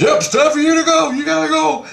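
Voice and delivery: very high, loud mock-urgent call; hammed-up high-pitched send-off; urgent retold order. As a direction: holler it high, hurrying someone along. Acting the dream figure ordering him off.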